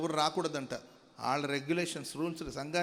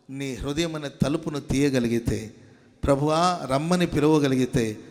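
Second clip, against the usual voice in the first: climactic preaching; 2 semitones higher, 21 dB louder than usual. Acted. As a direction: lift the whole room as the call peaks. Altar call climax. (శిఖరానికి చేరే బిగ్గర ప్రసంగం)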